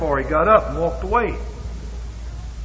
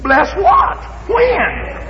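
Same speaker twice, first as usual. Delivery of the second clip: mock shout; much slower than usual. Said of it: "God demanding specifics".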